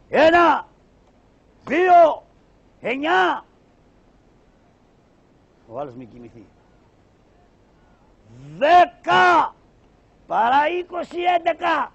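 loud, high calling out the numbers; high shouted counting. Acted. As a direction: bellow the count so the hidden man hears. Counting aloud as signal.

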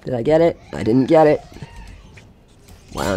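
ordinary delivery

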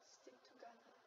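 hushed whisper. Real, not acted. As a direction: whisper it under the music. Whispering on the ride.

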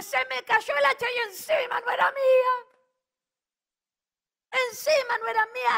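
loud, high, tearful cry of dismay; crying shout. Retold or imitated. The man who lost the axe, crying out loud. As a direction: wail over the lost axe. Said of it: "Gritó".

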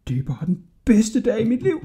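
put-on high, delighted young voice; thrilled boy. A narrator's reading. Hodja thanking El Fazer for the loan, thrilled. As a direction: gush with boyish delight, without shouting.

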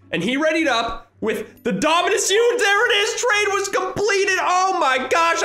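excited shout. Real, not acted. Streamer getting the rare pet, shouting with joy. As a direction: cheer like you just won.

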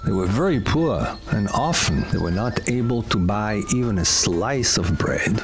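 plain reading voice

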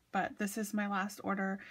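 ordinary talk

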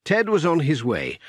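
usual speaking voice

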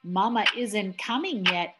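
ordinary delivery, nothing marked

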